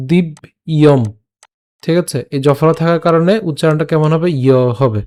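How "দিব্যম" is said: দিব্যম split slowly into its syllables; broken into syllables. Shown as a demonstration. Teacher splitting দিব্যম into parts for students, slowly. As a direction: pull দিব্যম apart syllable by syllable. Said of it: "উচ্চারণটা একটু ভেঙে বলছি".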